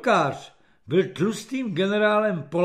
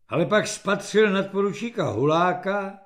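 usual speaking voice

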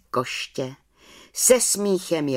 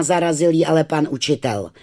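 usual speaking voice

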